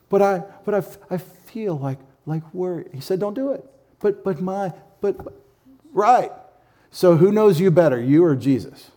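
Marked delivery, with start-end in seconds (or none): 0.1-6.3 higher, stammering, pleading excuses; whiny excuse. Pretend. Believer stammering excuses to Jesus the drill sergeant, whiny and higher. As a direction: play a fretful believer who can't let go.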